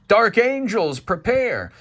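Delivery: high, loud rallying order; Gabe. Acted. Gabe rallies the dark angels, commanding. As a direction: call it out as a commander. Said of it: "so Gabe is like".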